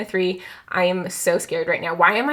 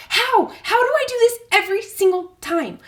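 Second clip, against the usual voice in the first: exasperated; 11 semitones higher than usual. Real, not acted. Losing again.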